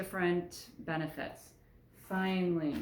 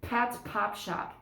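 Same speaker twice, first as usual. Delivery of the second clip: slow, crisp popping plosives; 3 semitones higher, slower than usual. Shown as a demonstration. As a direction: pop each P slowly through thin fabric. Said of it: "I can feel my breath".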